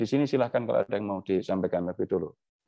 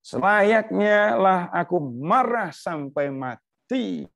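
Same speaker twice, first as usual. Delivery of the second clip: slow, higher, louder stubborn retort; sulky angry Jonah; 10 semitones higher, 5 dB louder, much slower than usual. Acted. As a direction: drag out every word like a defiant sulking prophet. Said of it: "ini Yunus sekarang jawabnya".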